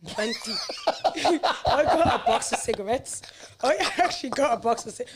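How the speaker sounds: can't believe it, laughing; laughing disbelief. Real, not acted. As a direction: giggle out the confession. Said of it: "I'm being dead serious".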